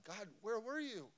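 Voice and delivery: retold yell, pitched high without much volume; restrained high-pitched cry to heaven; yelling at God. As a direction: re-enact crying out to God, voice climbing high. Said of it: "I'm just yelling at God".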